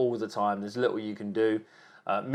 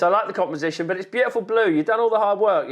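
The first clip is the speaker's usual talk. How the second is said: ordinary talk, nothing marked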